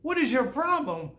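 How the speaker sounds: high-pitched, alarmed outburst; upset psychologist. Retold or imitated. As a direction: cry out in shocked dismay at the boy. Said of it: "the psychologist became quite upset".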